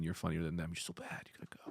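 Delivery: hushed seductive whisper, very soft; sexy voice. Pretend. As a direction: purr it like a come-on. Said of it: "You're doing a sexy voice".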